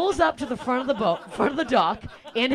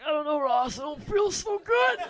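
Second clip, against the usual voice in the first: rising, dazed whine; mock woozy; 5 semitones higher than usual. Comic to Ross after the wipeout, mock woozy. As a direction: play it dazed. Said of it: "I'm like".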